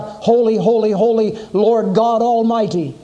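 high, loud heavenly proclamation; crying out. A narrator's reading. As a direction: ring it out like angels crying across heaven. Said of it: "crying out".

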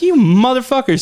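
hammed-up outrage; mock fury. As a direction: spit it at the repair company. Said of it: "I was like".

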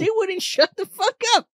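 high squeaky leprechaun, laughing. Retold or imitated.

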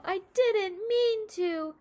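put-on high, loud, slow sobbing child; little boy sobbing his apology. A narrator's reading. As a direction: blubber it through tears. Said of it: "sobbed Tol".

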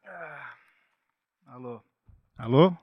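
voz sumindo com microfone cortado. Real, not acted. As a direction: fala baixa como microfone desligado. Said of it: "Tá, voltou meu microfone aqui?". (microfone cortando a voz)

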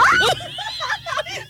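high shrieking burst of laughter. Real, not acted. Friend hears the nurse's reply, shrieking laugh.

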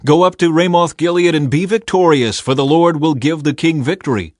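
high, loud prophets' endorsement; emphatic agreement. A narrator's reading. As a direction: urge the king on, voice lifted. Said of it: "All the other prophets agreed".

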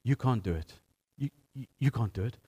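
low, quiet brush-off; a king quietly waving off a boy; dismissive king. Retold or imitated. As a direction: tell the boy he can't, flatly and quietly. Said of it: "he looks at David and he says".

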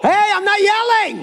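very high-pitched mock holler; mock yelling denial. Interpreter imitating people who deny yelling, mock yell. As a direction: protest in a yell that denies itself. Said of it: "Have you heard people say like me?".

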